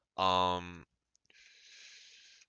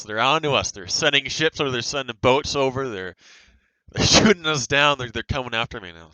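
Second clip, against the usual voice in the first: excited; 2 semitones higher, 6 dB louder than usual. Pretend. Mock war report of enemy attack.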